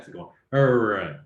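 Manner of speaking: "R" with the tongue tip curled back, slow; slow retroflex "R", tongue tip curled back. Shown as a demonstration. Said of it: "con la lengua así como curvada hacia atrás".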